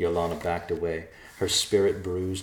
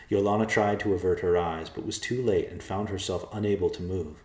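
ordinary delivery

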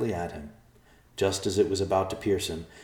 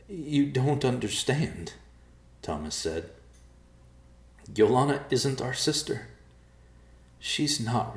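defeated; 7 semitones higher than usual. Acted. Defeated Thomas reveals truth.

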